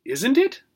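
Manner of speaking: high questioning rise on Isn't it; unsure gerbil asking the dog. A narrator's reading. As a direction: lift the end into a question. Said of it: "a question mark in her voice".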